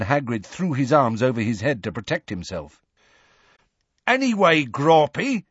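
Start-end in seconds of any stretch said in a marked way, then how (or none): 4.1-5.4 higher, louder call up to the giant; shouted. A narrator's reading. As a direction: call up to him, wary of eggs. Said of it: "shouted Hagrid".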